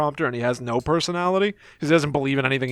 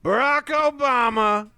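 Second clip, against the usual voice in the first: higher, slow rally shouting with gravel; Trump rally voice; 9 semitones higher, much slower than usual. Retold or imitated. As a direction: shout names to a crowd, slow cadence. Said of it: "he's going like".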